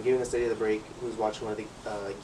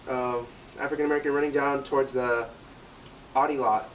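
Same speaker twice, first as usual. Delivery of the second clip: radio alert; 4 semitones higher, slower than usual. Retold or imitated. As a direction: bark the suspect's description over the walkie. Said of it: "what I do is like".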